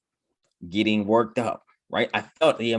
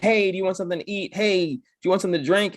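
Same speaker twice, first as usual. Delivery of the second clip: higher, quicker friendly offer; imitating someone kindly offering food; light mock helpful voice; friendly helper; 8 semitones higher, faster than usual. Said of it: "They're like".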